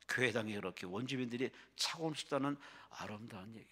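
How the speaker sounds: normal talking voice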